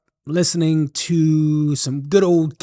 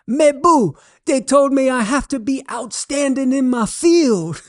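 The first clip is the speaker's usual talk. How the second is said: much higher Cajun punchline, matter-of-fact; 10 semitones higher than usual. Acted. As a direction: deliver the punchline in a high Cajun voice. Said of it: "Thibodeau says".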